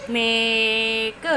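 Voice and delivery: मेक drawn out very slowly; stretched blend. Shown as a demonstration. Teacher stretching मेक for children, slow. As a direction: pull मेक long to show the vowel. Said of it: "एक".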